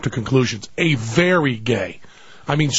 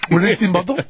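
halting question in a put-on Italian accent; mock Italian accent. Makeup man looking for the model, mock Italian accent. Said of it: "I'm gonna be doing an accent here".

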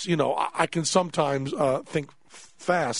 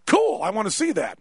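higher, mock-excited doctor; imitated keen interest; eager delight; 5 semitones higher than usual. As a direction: pipe up with gleeful curiosity, imitating the doctor. Doctor's eager reaction to injury.

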